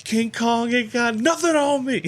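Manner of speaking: high, slow, put-on movie-star swagger; slow, boastful tough-guy line; Denzel. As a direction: deliver it like a cocky leading man, drawn out. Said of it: "if Denzel was standing in front of me".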